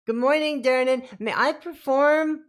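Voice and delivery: high, loud character voice; in-character greeting. Acted. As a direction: pipe up in a high-pitched voice, asking permission. Player voices character greeting the barkeep.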